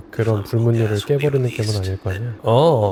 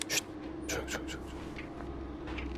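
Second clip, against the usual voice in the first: hushed breathy whisper, hiding; 20 dB quieter, slower than usual. Real, not acted. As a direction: breathe the words, barely audible. 숨어서 괴물 피하는 중. (숨죽인 속삭임)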